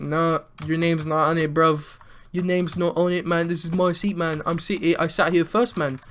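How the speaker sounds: put-on British accent; loosely faked British accent. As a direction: argue in a mock British accent. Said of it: "we're gonna say it's a British accent".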